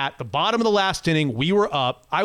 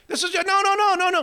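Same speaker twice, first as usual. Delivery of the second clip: insistent denial; 12 semitones higher than usual. Retold or imitated. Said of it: "And I was like".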